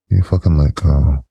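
normal talking voice

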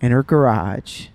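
slow, drawn-out Southern mom; matter-of-fact Southern accent. Acted. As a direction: imitate a Southern mother stating plain news, slowly. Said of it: "saying this matter of fact".

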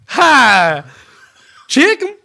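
loud, high mock Aussie kid greeting; Steve Irwin kid. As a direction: belt it out like an eager kid showman. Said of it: "he goes".

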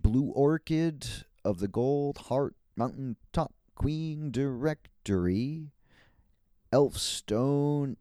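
loud, slow, drawn-out herald announcement; grand title proclamation. Pretend. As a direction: proclaim each part of the title with pomp. Recites own grand knight title.

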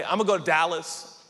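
brash, self-assured declaration; presumptuous announcement. Acted. Planner tells everyone about moving, cocky and certain. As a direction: announce the plan as already settled. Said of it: "you can't talk like that".

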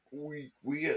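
words pushed out through a burp. Real, not acted. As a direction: burp while speaking. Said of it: "a crazy beer burp".

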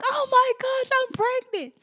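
very high-pitched re-enacted squeal; excited squeal. Woman at Target finding out she's pregnant, excited squeal.

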